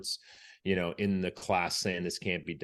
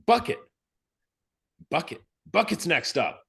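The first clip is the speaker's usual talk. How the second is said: high, loud shouted Bucket; shouting a name; 10 semitones higher, 7 dB louder than usual. Retold or imitated. Instructor shouting the next leader's name, high loud yell. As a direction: yell the name to be heard over the surf. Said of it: "And I hear".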